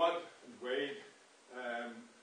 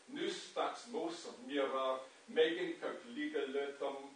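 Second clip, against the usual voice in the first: put-on slow, resonant reading of Old Norse verse; poem recitation; 2 semitones higher than usual. Lecturer reciting a Norse poem to the audience, measured and resonant. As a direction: declaim each line like an ancient skald.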